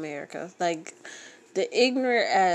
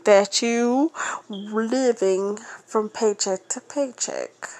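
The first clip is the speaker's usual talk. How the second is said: slow, louder, mock-sympathetic jab; condescending payroll lady; 3 semitones higher, 7 dB louder, slower than usual. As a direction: fake pity from the payroll lady. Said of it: "the payroll lady says".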